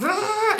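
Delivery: short high vroom noise; quick buzzing engine sound; imitated engine rev. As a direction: mimic a car accelerating. Imitates car speeding.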